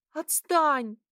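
high, loud desperate plea; pleading. A narrator's reading. Girl begging her attacker to stop, desperate. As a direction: cry out, begging to be left alone. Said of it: "взмолилась я".

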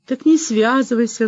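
brief flare of temper; outburst. A narrator's reading. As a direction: snap back in sudden anger. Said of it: "взорвалась была Света".

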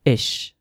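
short, isolated "ish" ending; "ish" as one short syllable, sh held; "ish" sound. Shown as a demonstration. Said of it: "the pronunciation is".

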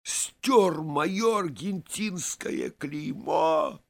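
high, slow, strained accusing question; strangled voice. A narrator's reading. Vaska confronts his friend over the shoes, in a strangled voice. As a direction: choke it out, high and drawn-out. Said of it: "спрашивает он сдавленным голосом".

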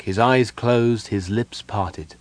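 ordinary delivery

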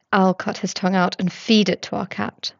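ordinary delivery, nothing marked

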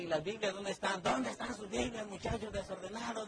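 put-on father scolding the messy kids, grumbling. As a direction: nag the kids about lost Bibles.

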